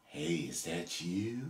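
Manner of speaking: hushed, low, slow creepy lure. Retold or imitated. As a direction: imitate a creep luring someone, very softly. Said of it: "That sounds creepy".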